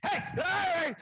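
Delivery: wild, high-pitched full-force hollering; agitated delirium yell. Acted. Lecturer shows what a dangerous delirium patient looks like, wild shouting. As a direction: bellow like a dangerous, out-of-control patient. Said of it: "what's this guy look like".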